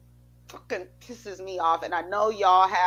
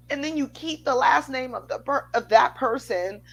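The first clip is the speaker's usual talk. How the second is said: real rising anger; 8 semitones higher than usual. Real, not acted. Ranting about women taking a husband's name, real anger. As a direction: demand it, voice climbing in outrage. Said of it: "I'm getting mad".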